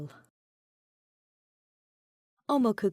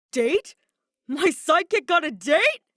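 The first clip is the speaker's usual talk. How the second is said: surprised shout; 8 semitones higher, 8 dB louder than usual. Acted. Kaito loudly blurts out the secret.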